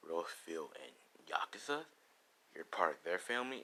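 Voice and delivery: low, hushed disbelief; soft, simmering contempt; hostile question. Acted.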